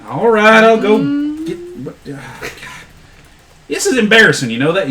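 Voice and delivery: high-pitched, put-upon complaint; grudging Chuck. Acted. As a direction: give in under protest, starting loud and high. Chuck grudgingly gives in, embarrassed.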